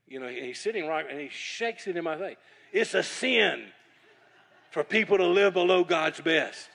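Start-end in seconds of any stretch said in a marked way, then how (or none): 2.7-6.7 imitating Bob's booming rebuke, louder and higher; loud preaching. Bob shaking chicken at him, loudly preaching. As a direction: boom it out like a prophet scolding you. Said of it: "he's talking like that".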